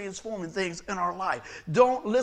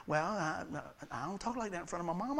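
mock sheepish stammer; 3 semitones lower, 10 dB quieter than usual. Guys caught out about cussing.

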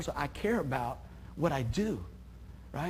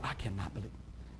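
whispered shocked aside; scene: pastor mimics churchgoers' shocked gossip, whispered; 9 semitones lower, 13 dB quieter than usual. Hushed disbelief. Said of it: "So many people".

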